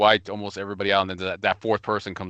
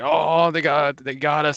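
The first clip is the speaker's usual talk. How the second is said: higher-pitched mock yell, not full volume; imitated scream; 6 semitones higher, much slower than usual. Imitating the enemy's buddy on comms, half-hearted scream. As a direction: mimic a panicked call-out lightly, pitched up. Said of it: "like, screaming, like".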